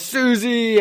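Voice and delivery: high, loud call of a name; mock yell. Johnny yells to Susie as he flies off to help, mock yell. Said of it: "He yells".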